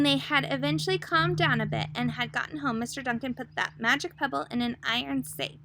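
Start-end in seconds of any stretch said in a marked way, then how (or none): none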